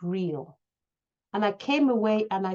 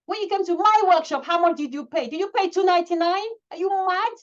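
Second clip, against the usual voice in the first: loud, higher exasperated questions; shouting; 8 semitones higher, 4 dB louder than usual. Real, not acted. Quoting her coach's rebuke to herself, shouting. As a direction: shout it in disbelief. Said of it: "I'm sorry I'm shouting".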